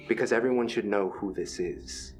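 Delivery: ordinary talk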